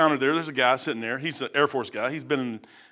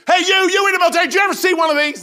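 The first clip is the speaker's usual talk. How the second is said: imitated loud veteran; 14 semitones higher, 7 dB louder, faster than usual. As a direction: shout it like a gruff old airman thrilled by a tract. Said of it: "this is the way he's talking".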